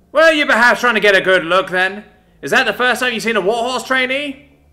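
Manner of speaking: loud, high sarcastic yell; mocking shout. Sergeant loudly mocking the trainee's gift, high shout. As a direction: needle him at full volume.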